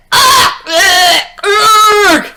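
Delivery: high strangled cries; hammed-up choking; police groaning. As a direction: gag and collapse. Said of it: "They went".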